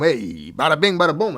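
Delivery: mock gangster flourish; mobster exclamation. Shown as a demonstration. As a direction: toss it out like a wiseguy. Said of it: "I should say it like that".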